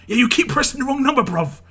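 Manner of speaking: high, loud telling-off; retold angry shout. Woken resident to wrong-bell ringers, angry shouting. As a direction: yell your complaint down from a balcony. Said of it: "I just shouted at them".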